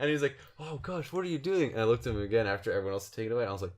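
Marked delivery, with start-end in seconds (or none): 0.6-1.7 retold dismay. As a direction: act out his appalled reaction, pitched up. Retold horrified reaction to bite.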